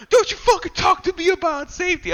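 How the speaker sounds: high, furious yell in his face; a loudmouth getting in someone's face; angry yell. Retold or imitated. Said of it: "always, like, yelling at people".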